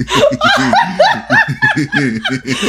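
real laugh, shooting up very high; long high cackle of real laughter; high squealing laugh. Real, not acted. Cracks up at the joke.

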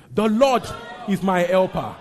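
very loud, high-pitched declaration; confident proclamation. Shown as a demonstration. Preacher showing the congregation confidence, loud bold declaration. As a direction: proclaim it with full conviction. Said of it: "Say it with confidence right now".

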